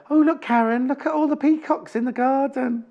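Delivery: high-pitched, wide-eyed mock marvelling; Hannah. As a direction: put on a high, gushing voice for an imagined friend. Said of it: "She doesn't talk like that".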